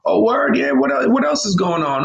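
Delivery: high street-casual pal; American slang buddy. Retold or imitated. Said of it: "how we talk in America".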